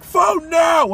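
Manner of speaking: high, loud parental demand; mock-shrill confiscation order; furious retold order. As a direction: imitate an angry parent's command. Retelling parent confiscating phone.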